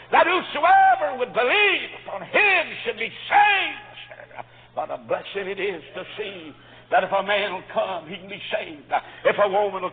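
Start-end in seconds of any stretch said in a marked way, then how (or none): 0.1-4.5 high, loud and slow; fervent preaching. Real, not acted. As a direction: lift the promise up. Preacher climaxing.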